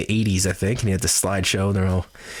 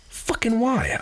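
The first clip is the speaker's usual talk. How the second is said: high, strained disbelief; pitched-up exasperated why; baffled outburst; 12 semitones higher, slower than usual. Real, not acted. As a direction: throw up your hands and ask why. Baffled at his urge.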